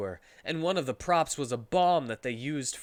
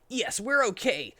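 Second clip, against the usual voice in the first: lightly voiced Sonic; 8 semitones higher than usual. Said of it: "said Sonic".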